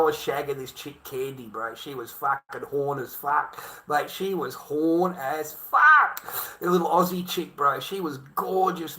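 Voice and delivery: ordinary delivery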